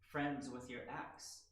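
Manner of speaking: normal talking voice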